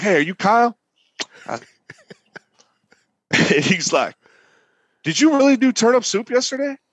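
high-pitched incredulous questioning, imitated; lightly voiced tipsy guy accosting someone; tipsy stranger. Tipsy man quizzes the cook about soup, lightly imitated. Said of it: "he's been drinking a little bit".

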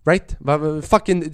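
normal talking voice

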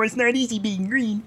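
high, thin frog puppet voice; Kermit the Frog voice. Retold or imitated. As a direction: croon it like a felt frog puppet. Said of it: "he fucking sounds like Kermit the Frog".

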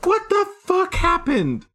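high, put-on voice of confused shock; comic high-pitched dismay; baffled character voice. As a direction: squeak it out like the bucket betrayed you. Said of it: "I'll be like".

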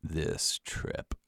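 low, very soft mumble; mumbled. A narrator's reading. Dad to himself with head bowed, mumbling. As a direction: mumble it under your breath. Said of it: "as he mumbled".

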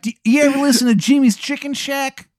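high, loud Baltimore wizard voice; terrible Maryland accent. Acted. Wizard asks Adam about a band, terrible Maryland accent. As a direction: pitch up into an exaggerated Maryland accent. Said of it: "So that's his accent".

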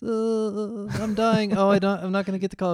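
hammed-up fake injury, pitched high; Paul Rubens. Player faking injury to get a yellow card, hammed-up moaning. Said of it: "Rubens is just trying to get the".

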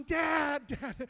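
loud, high call fading on the repeat; calling out to a father; shouting. Retold or imitated. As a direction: shout out for Dad, then ease off. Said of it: "I'm shouting".

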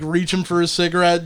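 ordinary talk, nothing marked

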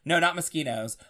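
normal talking voice

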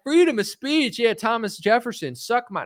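put-on high-pitched kid protest; mock rebellious child voice; defiant fifth grader. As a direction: pipe up like a cheeky schoolkid.

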